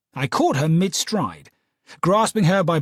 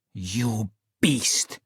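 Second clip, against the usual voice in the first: angry cry; 5 semitones lower than usual. Acted. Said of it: "she cried".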